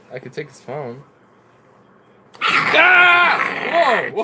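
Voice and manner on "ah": sudden high shriek of fright; a real jump-scare yelp; startled scream. Real, not acted.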